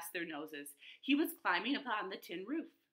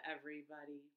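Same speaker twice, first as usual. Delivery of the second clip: usual speaking voice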